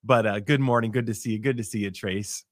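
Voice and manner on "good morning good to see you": ordinary delivery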